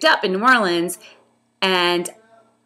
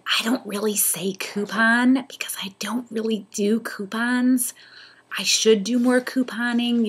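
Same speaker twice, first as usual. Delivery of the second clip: whisper; 3 semitones higher than usual. Real, not acted. Guilty aside about coupons.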